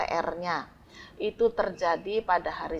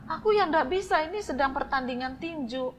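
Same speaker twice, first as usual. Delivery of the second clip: father refusing; 7 semitones higher than usual. Acted. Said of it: "Bapaknya bilang juga".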